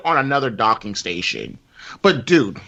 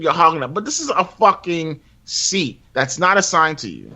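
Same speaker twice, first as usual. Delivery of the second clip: usual speaking voice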